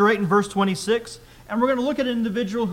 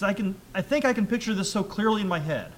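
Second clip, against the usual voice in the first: ordinary talk, nothing marked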